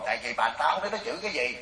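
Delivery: normal talking voice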